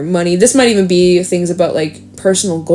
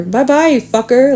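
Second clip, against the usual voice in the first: higher, playful taunting goodbye; pitched-up kiss-off; mocking farewell; 5 semitones higher than usual. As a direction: wave them off with cheeky, mock sweetness. Playful insulting farewell, acted.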